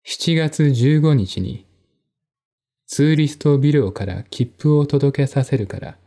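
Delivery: ordinary delivery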